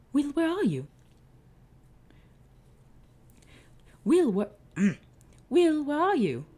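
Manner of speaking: loud, high searching call. Acted. Akmun Ra searches for Will, loud high call. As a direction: shout down the hallway.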